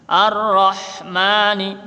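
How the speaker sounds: Ar-Rahmani with pressed rr and ma held two beats; long ma. Shown as a demonstration. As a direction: stretch the ma in Ar-Rahmani. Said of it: "ma-nya mat tabi'i".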